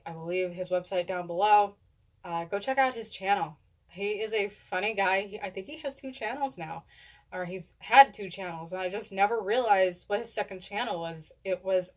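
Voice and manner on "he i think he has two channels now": usual speaking voice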